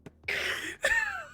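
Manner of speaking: high squealing laugh; real high-pitched giggle fit; helpless laughter. Real, not acted. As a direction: crack up completely at the silly line. Cracks up at the line.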